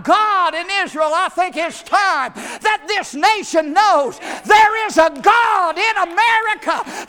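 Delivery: preacher at a fever-pitch shout before his church. Real, not acted. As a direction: cry it out to the congregation. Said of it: "I'm about to shout".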